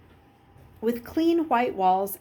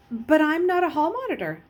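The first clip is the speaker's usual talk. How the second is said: higher, louder protest; boyish protest; 8 semitones higher, 5 dB louder than usual. Acted. Luke protesting to Jackal Boy, higher and louder. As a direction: object earnestly.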